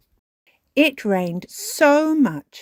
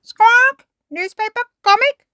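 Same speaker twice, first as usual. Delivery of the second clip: very high, loud squawked words; parrot mimicry; 10 dB louder than usual. A narrator's reading. Polly copies the dogs' order, high parrot squawk. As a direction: squawk each item back like a bird. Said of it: "Polly Parrot copied".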